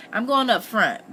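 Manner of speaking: plain reading voice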